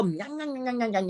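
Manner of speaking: mocking cud-chewing "nyah nyah"; mock chewing noises. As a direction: imitate toothless chewing in a run of nyahs. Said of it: "like you're chewing your cud".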